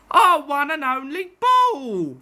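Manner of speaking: sad, with a put-on voice; mourning the lost ball, a touch plaintive; sadly. Cricketers mourning their lost ball, slightly sad. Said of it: "they said sadly".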